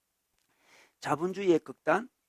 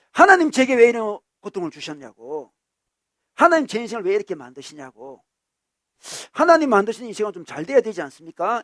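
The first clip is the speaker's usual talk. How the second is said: loud, raised-pitch complaint; protesting to God; 3 semitones higher, 9 dB louder than usual. Acted. Believer blaming God for a ruined life, loud protest. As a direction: demand an answer from God. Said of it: "이렇게 주장을 합니다".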